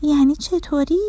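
put-on high-pitched child's question; mildly surprised, wondering how; puzzled surprise. A narrator's reading. Dorna asks Mr Result how it works, mildly surprised. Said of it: "با تعجب پرسید".

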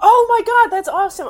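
high, loud burst of delight; excited. Acted. Roommate thrilled by the melodica, loud and high. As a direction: gush over the melodica like it's the best thing.

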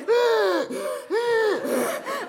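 high, loud acted gasps; hammed-up sharp intakes of breath; retold horrified gasp; panicked gasping. Retells horror at empty gas meter.

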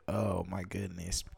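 drained and quiet; weary sigh. Real, not acted. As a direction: exhale it, worn out. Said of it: "I am so tired".

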